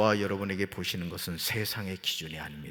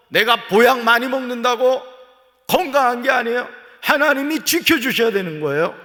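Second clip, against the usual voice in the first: loud, high pulpit preaching; fervent preaching; 10 semitones higher, 10 dB louder than usual. Real, not acted. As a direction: drive it home to the congregation. Preaching at full force.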